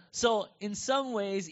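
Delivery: usual speaking voice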